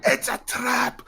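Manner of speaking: hammed-up Scottish accent quote. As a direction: play the Scottish villain booming, and drag out kill them all.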